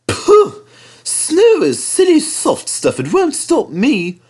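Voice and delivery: high, slow scoffing engine voice; cheeky little engine. Acted. Thomas scoffs at the snow.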